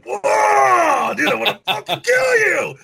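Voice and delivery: high, loud mock-furious yell with a laugh breaking in. Singer recalling rage at his producer, shouting high and loud. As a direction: shout it like you could kill the producer, half laughing. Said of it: "That would just be like".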